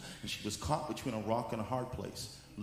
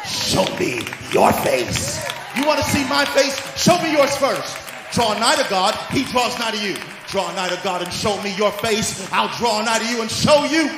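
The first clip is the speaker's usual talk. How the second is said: shouted sermon, much louder and higher; full-force preaching; 9 semitones higher, 13 dB louder than usual. Acted. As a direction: thunder the divine invitation to the congregation.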